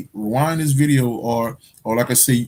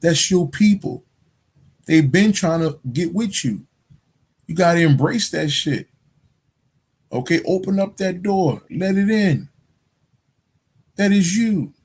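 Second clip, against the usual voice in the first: lightly voiced wise friend urging him to accept; Bobby advising; 7 semitones higher than usual. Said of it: "Bobby tell him".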